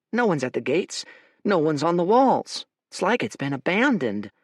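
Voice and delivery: a hint of a wary soldier voice; uneasy scout. Scout tells Fate the fortress is empty, uneasy. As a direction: report it like it makes no sense.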